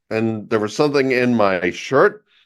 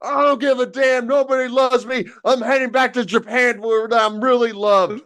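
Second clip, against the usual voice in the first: high mock whining dad; hammed-up self-pity; 9 semitones higher than usual. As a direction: wail it like a sulky drunk dad. Drunk dad sulks, voiced.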